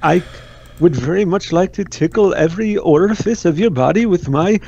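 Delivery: a creepy, wandering bad Indian accent; deliberately clumsy Indian accent to creep out the chat; mock Indian accent. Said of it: "That accent is all over the place".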